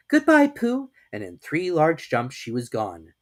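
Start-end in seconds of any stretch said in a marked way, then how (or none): 0.1-0.9 high, loud farewell; Kanga. A narrator's reading. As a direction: call it out high in character. Kanga says goodbye to Pooh.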